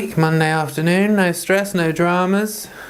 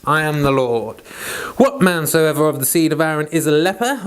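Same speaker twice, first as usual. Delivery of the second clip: measured King James Bible reading; steady reading of Leviticus aloud; scripture reading; 2 semitones higher than usual. Acted. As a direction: read it out like a lesson in church. Reads Leviticus chapter aloud.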